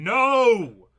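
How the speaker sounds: loud, high drawn-out "no"; screaming. Acted. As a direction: scream it out like a panicked warning. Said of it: "your party is screaming".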